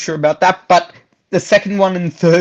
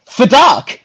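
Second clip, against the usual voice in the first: mock euphemism; 7 semitones higher than usual. Shown as a demonstration. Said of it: "Or you could just say".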